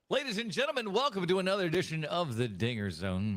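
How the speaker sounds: high-pitched announcer opening, easing down; big podcast intro, starting high; show announcer. Real, not acted. Second take of show introduction.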